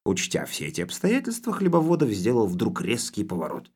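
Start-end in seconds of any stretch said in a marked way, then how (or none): none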